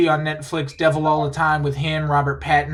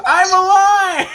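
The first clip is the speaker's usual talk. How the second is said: high, loud, slow triumphant yell; Tom Holland excited yell; 7 dB louder, slower than usual. Retold or imitated. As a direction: burst in shouting it like a thrilled kid. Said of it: "he goes".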